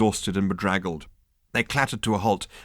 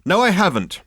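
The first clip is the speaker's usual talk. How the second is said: firmer, louder, higher insistence; stronger; 11 semitones higher, 7 dB louder than usual. A narrator's reading. Ford pressing his news on the captain, more forceful. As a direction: push it harder this time. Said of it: "he'd be a little stronger on the subject".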